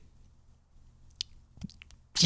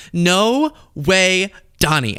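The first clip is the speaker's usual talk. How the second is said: Xi Jinping; 7 semitones higher, much slower than usual. Acted. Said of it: "And Xi Jinping went".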